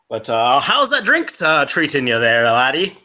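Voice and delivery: a bad Liam Neeson-style Irish accent; an Irishman with a shaky brogue. Irish traveller asking the scholar about libraries, bad Irish accent. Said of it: "You're at a bad Liam Neeson impression".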